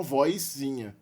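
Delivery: "vozinha" said clearly as "voizinha"; inserted i glide. Shown as a demonstration. Teacher deriving the diminutive from voice, i glide in "vozinha". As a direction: sound an i before the z of "vozinha". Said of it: "a inserção do i antes do".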